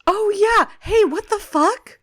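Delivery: high, incredulous hey what the fuck; surprised outburst. Real, not acted. Cohost reacting to Autobot naming, incredulous yelp. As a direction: yelp it in disbelief.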